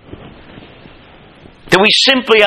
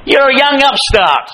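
higher contemptuous big-brother voice; Eliab's contempt; 9 semitones higher than usual. Retold or imitated. Eliab sneering at his young brother, higher and scornful. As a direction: look down on the upstart. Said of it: "He says to him".

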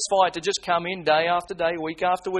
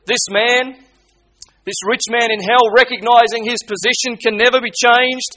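louder, higher preaching at full force; fiery preaching; 5 semitones higher, 6 dB louder than usual. Acted. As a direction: thunder it from the pulpit, pressing them hard. Preacher building up the rich man.

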